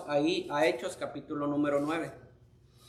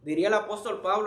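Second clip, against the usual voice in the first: ordinary talk, nothing marked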